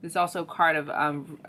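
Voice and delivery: normal talking voice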